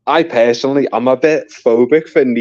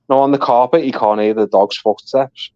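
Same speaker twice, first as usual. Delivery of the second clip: ordinary talk, nothing marked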